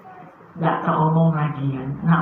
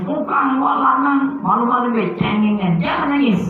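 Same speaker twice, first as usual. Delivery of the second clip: scolding parent; 6 semitones higher than usual. Retold or imitated. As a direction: play the parent telling the son off. Parent scolds crying son.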